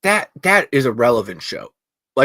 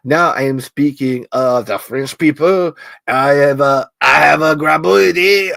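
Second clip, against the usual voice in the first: louder mock French accent; 5 dB louder than usual. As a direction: ham up a French speaker. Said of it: "now I've gone French".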